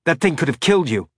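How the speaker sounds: high, tight, fast pinched voice. Acted. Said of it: "his voice high and tight".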